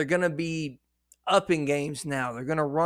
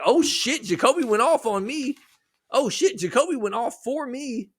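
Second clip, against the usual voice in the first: higher, louder mock amazement; imitated excited fan; 8 semitones higher, 3 dB louder than usual. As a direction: act out a pleasantly shocked fan. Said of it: "you kind of went like".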